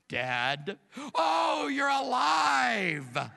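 high, loud, very slow cry of relief; hammed-up daughter shouting as if Dad survived; mock relieved shout. Daughter spots her frantic father, mock relieved shout. Daughter returns, sees the yelling.

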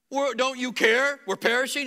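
mock-panicked yell in the storm; panicked disciple. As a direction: disciples crying out in the sinking boat. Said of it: "Is that what Peter said?".

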